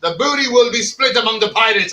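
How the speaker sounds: lightly voiced Irish pirate; a hint of a pirate captain. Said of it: "it sounded like an Irish pirate".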